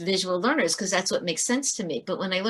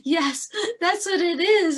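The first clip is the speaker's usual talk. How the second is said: much higher, slow tearful yes; a relieved crying mother; mother through tears, agreeing with the teacher; 10 semitones higher than usual. Retold or imitated. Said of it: "the mother's like".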